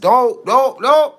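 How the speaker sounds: high, loud pleading don'ts, rising each time; daughter's urgent protest. Retold or imitated. Daughter stopping dad from buying a toothbrush, high loud protest. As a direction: stop him fast, voice climbing. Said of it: "She said".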